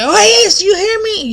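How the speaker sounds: high, loud mock yell; grandma yelling. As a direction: holler it high, demanding an answer. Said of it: "she wanted to make sure you heard her".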